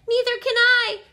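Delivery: high, loud, wailing howl. A narrator's reading. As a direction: howl it in alarm. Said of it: "howled Flitter".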